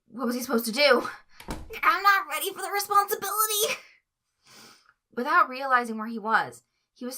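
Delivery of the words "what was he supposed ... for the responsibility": high, loud, strained fretting; anxious panic. Acted. Tweek doubting he can care for Stripe, high anxious wail. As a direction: rise up into panic on the last line.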